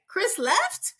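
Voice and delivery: shocked disbelief. Retold or imitated. Scott shocked that Chris left him, high disbelief. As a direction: act stunned and betrayed, way up high. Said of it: "He's like".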